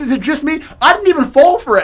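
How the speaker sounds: normal talking voice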